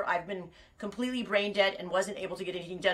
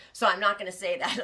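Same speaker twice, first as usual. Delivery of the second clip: usual speaking voice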